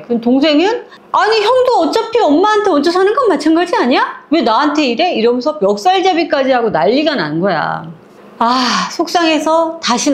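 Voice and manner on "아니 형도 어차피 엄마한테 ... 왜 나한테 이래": high-pitched acted indignation; quarrelling retort. Younger brother argues back at his older brother, indignant. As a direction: throw the blame back in a raised pitch. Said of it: "시동생하고 남편하고 한판 붙었어".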